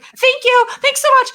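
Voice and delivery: overly sweet high thank-you; high grateful kid. Retold or imitated. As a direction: kid thanks in a voice pitched well up. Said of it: "they were like".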